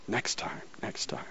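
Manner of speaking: voiceless pleading whisper; whispered plea. Pretend. His body telling Garth to pick hot water, whispered. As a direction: hiss it like the body begging.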